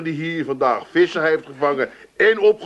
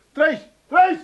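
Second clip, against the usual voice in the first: high call through the house; 12 semitones higher, 3 dB louder than usual. Acted. Husband calling out for his wife on coming home. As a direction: call her from the doorway.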